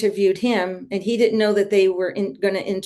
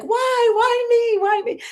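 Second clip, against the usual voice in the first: very high retold cry of protest; mock yelling. Devotee re-enacting yelling at Krishna, high mock wail. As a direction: wail it upward, imitating a yell. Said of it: "kinda yelling at Krishna".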